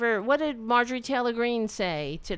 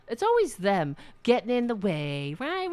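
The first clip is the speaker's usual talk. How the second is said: ordinary talk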